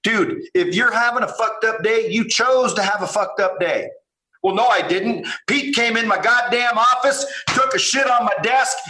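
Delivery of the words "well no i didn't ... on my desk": mock angry rant. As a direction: shout the grievance like a victim of the day. Mock venting about a terrible day.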